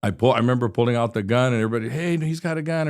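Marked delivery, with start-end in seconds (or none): 1.9-2.9 retold alarm. Bystanders warning each other about the gun, retold alarm. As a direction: imitate a bystander's warning cry.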